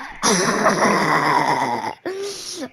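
howling shrieking laughter; screaming laugh. Real, not acted. Wild laugh at glitch.

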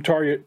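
Tarja with the J said as Y, TAR-ya; soft J as Y. Shown as a demonstration. Host correcting their own slip on the singer's name, [name] pronounced TAR-yah. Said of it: "I said [name], I know".

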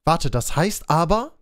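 ordinary talk

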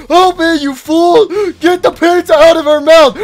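high loud plea for help; urgent shout. Acted. Calling for help.

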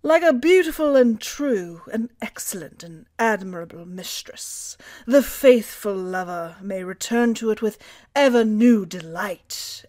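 put-on British accent. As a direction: quote it like a posh British narrator. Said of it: "I'll do British".

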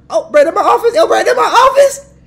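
very high, very loud yell; excited yelling. Real, not acted. Streamer reacting to his in-game office, excited yelling. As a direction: shriek it at the top of your range.

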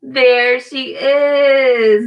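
slow, drawn-out happy reveal; slow delighted there she is. Acted. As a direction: stretch it out with delight. Mouse found under square.

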